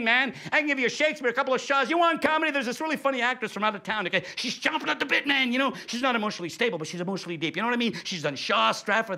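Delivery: hammed-up dodgy dealer pitch; street hustler. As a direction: push the deal like a slick street seller. Hustler pitch continues, higher voice.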